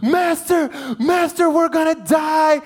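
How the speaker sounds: high, loud mock-panicked cry; panicked disciples. Disciples waking Jesus in the storm, mock panic. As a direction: play the terrified disciples crying for help. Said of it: "the disciples come up and they're like".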